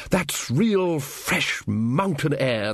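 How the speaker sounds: very slow, theatrical relish; savouring wizard. Acted. Ridcully showing off the mountain air, theatrically savouring it. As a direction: dwell grandly on every word.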